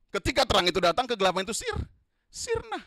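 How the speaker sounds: loud, high proclamation; preaching at full force. Acted. Preacher declaring light drives out darkness, loud and high. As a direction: ring it out triumphantly.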